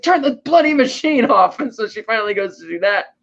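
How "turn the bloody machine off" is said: high, urgent mock command; urgent demand. Hero in danger to his helper, urgent demand. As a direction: imitate an exasperated plea for help.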